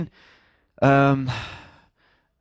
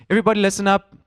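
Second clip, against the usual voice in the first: raised, higher call to the room; calling for attention; 7 semitones higher, 4 dB louder, much faster than usual. Acted. Worship leader addressing his people, calling for attention. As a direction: get the whole crowd listening. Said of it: "in today's language is saying".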